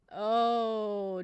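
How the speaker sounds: ordinary talk, nothing marked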